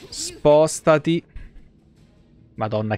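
ordinary delivery

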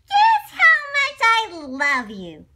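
high, loud, slow eager question; a tiny bunny begging to be heard; little bunny. Acted. Little bunny asking dad, loud and eager.

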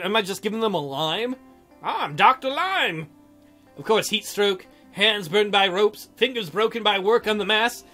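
ordinary talk

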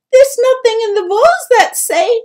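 high, loud pleading; anxious protest. A narrator's reading. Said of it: "Twig stammered".

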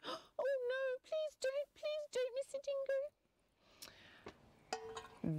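tiny high squeal of a frightened wombat; high-pitched, panicky pleading. Acted. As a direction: beg for your life in a little high voice. Caught wombat begs the dingo.

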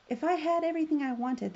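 high, loud snappy comeback; sharp retort. A narrator's reading. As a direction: throw it back at the frog. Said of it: "the princess retorted".

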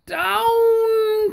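high, ringing command down to the ground; witch's cry. A narrator's reading. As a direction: call the broom down like a witch. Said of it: "cried the witch".

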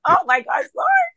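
high-pitched, drawn-up mock wail; exasperated plea. Wife answering the Lord's rebuke, high exasperated plea. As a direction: re-enact exasperation, rising very high. Re-enacted exasperated reply to the Lord.

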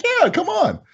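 very high come on; excited invite. Retold or imitated. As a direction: call her in eagerly. Inviting customer enthusiastically.

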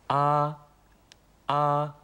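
"あ" with the mouth wide open, high and held level; wide-open Mandarin a. Shown as a demonstration. Teacher modelling the first vowel, wide-open high "あ". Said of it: "口を大きく開ける".

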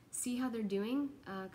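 ordinary talk

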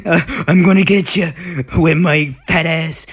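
loud, high mock-menacing tease; playful menacing voice. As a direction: threaten in a goofy voice. Playful threat to dinosaur.

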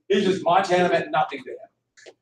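usual speaking voice

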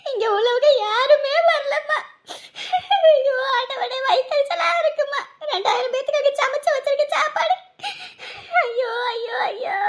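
high, anguished tearful wail; acted bitter weeping; sobbing lament. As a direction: cry out in despair, breaking into sobs. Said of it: "அழாதக்கா".

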